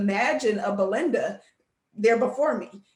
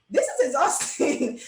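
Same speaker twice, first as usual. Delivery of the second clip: drawn-out, raised-pitch mock exasperation; exasperated; 4 semitones higher, slower than usual. Engineer retelling her reaction to work, mock exasperated. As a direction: play up being worn out, slow and higher.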